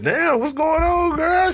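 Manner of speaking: high, slow mock greeting; greeting coworker. Greeting the older coworker dancing at work, high and playful. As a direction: call out high and drawn out, playful. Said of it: "I said".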